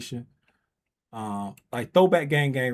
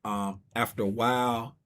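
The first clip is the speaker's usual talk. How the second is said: ordinary delivery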